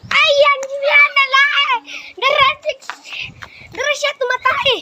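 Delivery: high, eager spotting voice; excited high calls. Real, not acted. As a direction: call out excitedly.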